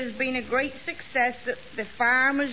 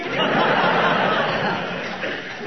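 high silly giggle, a little louder; 4 semitones higher, 4 dB louder than usual. Acted. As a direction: giggle at your own joke like a silly girl. Girl giggles at own joke.

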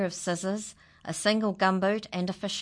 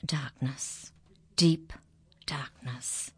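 hushed; 2 semitones lower, slower than usual. A narrator's reading. Narrator whispering of the dark barn.

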